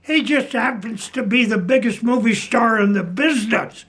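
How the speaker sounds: high, disbelieving cry of who's he; stunned that she has never heard of him; mock outrage, pitched well up; incredulous outburst. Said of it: "He says".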